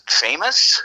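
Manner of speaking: high, rising questioning echo; acted-out disbelief, voice jumping up; incredulous echo. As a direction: repeat it back as a startled question, pitch lifting.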